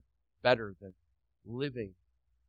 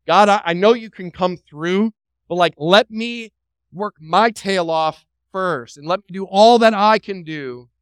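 mock pleading; 5 semitones higher, 10 dB louder than usual. Voicing self-reliant believer.

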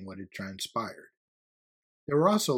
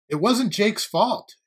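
raised, high, slow protest; calling out from crowd; 11 semitones higher, 8 dB louder, slower than usual. A narrator's reading. Student in the crowd defending Jake, calling out. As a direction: speak up loudly over a crowd. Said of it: "came a voice from the crowd".

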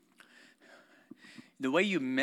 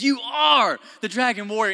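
emphatic master; 8 semitones higher, 7 dB louder than usual. Retold or imitated. Master telling his doubting student who he is, emphatic. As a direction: declare it loudly, like a master's pronouncement. Voicing master addressing the warrior.

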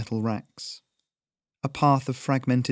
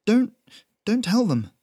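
much higher, trembling plea; pleading in fear; 11 semitones higher, 5 dB louder than usual. A narrator's reading. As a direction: beg desperately, stammering. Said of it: "hands up and trembling".

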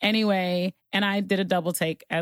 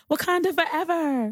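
much higher hammed-up Wakanda forever; mock fan cry; 12 semitones higher than usual. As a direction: ham it up like an overeager fan. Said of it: "I wasn't like".

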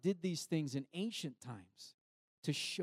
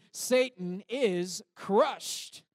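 higher, very slow and loud; loud slow declaration; 5 semitones higher, 7 dB louder, much slower than usual. Shown as a demonstration. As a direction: shout it out slowly with the church. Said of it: "join us".

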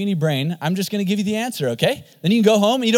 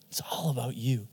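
fading whisper, even softer; 6 semitones lower, 12 dB quieter than usual. Pretend. Pastor repeating the world's lie in a fading whisper. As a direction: let it trail off into a hush.

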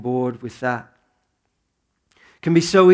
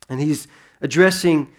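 ordinary delivery

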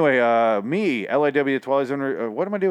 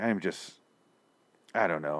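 normal talking voice

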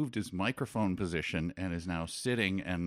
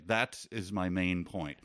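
usual speaking voice

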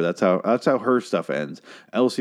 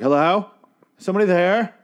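nervous call out; 10 semitones higher, 4 dB louder than usual. Retold or imitated. Elsie calling out in horror scene.

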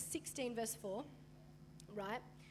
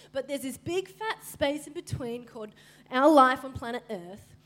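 loud, urgent preaching voice; 8 dB louder than usual. Retold or imitated. Preacher voicing Jesus urging believers forward. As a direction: drive it home with force.

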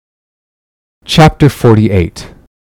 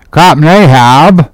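slow, raised hail; calling out; 4 semitones higher, 3 dB louder than usual. A narrator's reading. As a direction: call up to your captain across the water. Starbuck hails his captain from boat.

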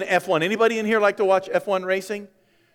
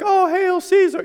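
high, loud mock cheer; cheering crowd; 11 semitones higher, 4 dB louder than usual. Crowd greeting a victorious Caesar, high and cheering. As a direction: hail him like an ecstatic crowd. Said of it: "people are like".

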